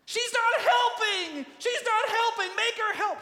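high, loud, frantic complaint; outraged shrieking protest; exasperated shouting. Acted. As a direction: scream the grievance, demanding action. Preacher voices Martha's outraged complaint.

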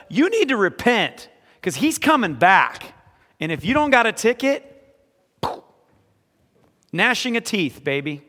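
mocking a hellfire preacher's warning. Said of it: "That's not the gospel".

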